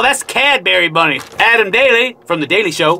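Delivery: ordinary talk, nothing marked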